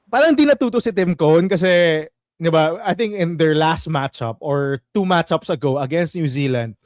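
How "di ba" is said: ordinary delivery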